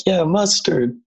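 high, bright-eyed mustard cheer; excited. Retold or imitated. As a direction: pitch it way up like mustard thrills you. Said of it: "be like".

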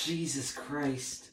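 very quiet, drawn-out exclamation; hushed, as if sent into prayer; mock-prayerful. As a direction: breathe it slowly under your breath. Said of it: "I was thanking Jesus".

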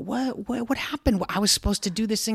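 high, baffled "what happened?"; protesting in disbelief; indignant. Retold or imitated.

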